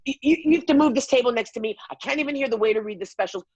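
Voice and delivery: fast, high, sputtering complaint; indignant demand. Retold or imitated. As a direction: rattle off an outraged demand, mimicking an upset diner. Acted complaint to the owner.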